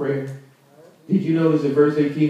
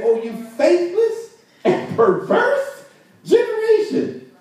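high, slow exasperated rebuke; fed-up rebuke, pitched way up; Jesus with an attitude; 12 semitones higher than usual. Retold or imitated. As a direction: scold them with attitude, drawing out each word. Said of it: "sounds like Jesus got a toot on him".

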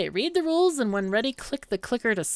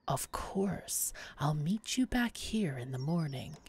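hushed, secretive agreement; whispered promise; 7 dB quieter than usual. Acted. Sellsword whispers agreement to the mage's secret plan. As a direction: keep the deal secret.

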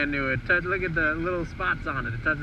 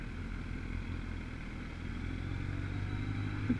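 long laugh; 13 dB quieter than usual. Real, not acted.

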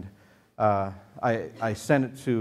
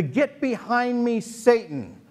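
high, slow, drawn-out "Get behind me, Satan"; mock-solemn rebuke, higher and slow; dramatic rebuke; 9 semitones higher, slower than usual. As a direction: lean on "Get" high and slow like a grand scolding. Jesus rebuking Peter.

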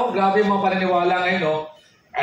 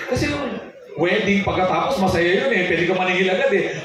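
usual speaking voice

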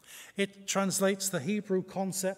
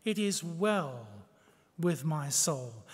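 tender, slow; 5 semitones lower, slower than usual. Shown as a demonstration. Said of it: "that's what shalom is".

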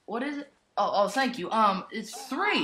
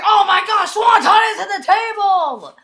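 high, loud, laughing yell; thrilled commentator shout with a laugh; excited shout; 6 semitones higher, 10 dB louder than usual. Real, not acted. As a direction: cheer the big dive. Swanton dive onto table.

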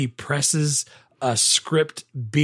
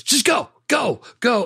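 high, slow, shooing along; 9 semitones higher than usual. Retold or imitated. Fox on hold, shooing the bellhop to vacuum, high. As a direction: wave him off with your voice. Voicing shooing him away.

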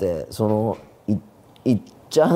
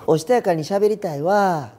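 higher, put-on demure lilt; demure and ladylike; 7 semitones higher than usual. Shown as a demonstration. Trying out more refined Kansai speech, demure and high. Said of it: "もっとおし-、おしとやかに喋りたいな".

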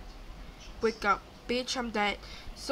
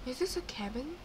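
hushed wondering; 7 dB quieter than usual. Real, not acted. Peers into a new floor.

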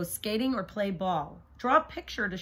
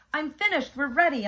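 excited; 6 semitones higher, 6 dB louder than usual. Acted. Shayla done with chores.

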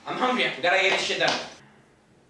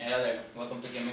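dead voice; 12 semitones lower, 10 dB quieter, faster than usual. Acted. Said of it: "Go back with my dead voice".

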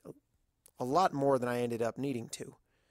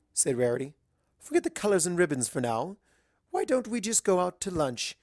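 a hint of a genteel lady; lightly voiced polite unicorn; lightly voiced refined mare; 6 semitones higher than usual. Rarity voiced in story.

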